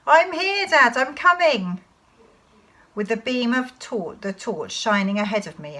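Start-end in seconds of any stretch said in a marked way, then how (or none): 0.1-1.8 high, loud answering shout; calling back. Acted. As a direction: shout to dad while running to him. Child calls back to father.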